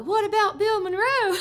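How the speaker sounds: high, laughing call from the crowd; heckler's yell. Retold or imitated. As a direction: toss it up at the stage with a laugh in it. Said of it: "somebody else yelled".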